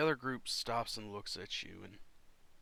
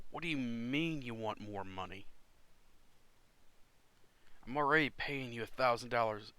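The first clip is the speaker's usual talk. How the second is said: mildly exasperated captain, raised voice; put-out captain arguing over pay; indignant captain. Acted. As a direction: haggle indignantly over the price. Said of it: "captain's looking, goes".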